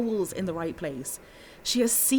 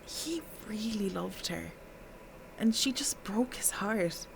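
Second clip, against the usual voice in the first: hushed confiding; 2 semitones higher, 8 dB quieter than usual. Acted. Witness confides about Pete's heartbreak.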